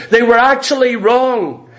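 ordinary talk

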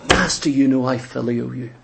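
Peter, heartbroken, answering Jesus the third time; broken-hearted Peter. Acted. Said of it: "His heart was broken".

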